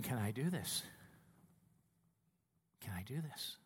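low, soft question to oneself; hushed self-doubt. Acted. Student pilot asking himself on final approach, hushed doubt. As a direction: barely voice it, as a private worry.

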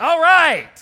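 high, loud joyful shout; excited cheer. Real, not acted. Pastor cheering the church's response, loud and high. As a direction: cheer them on.

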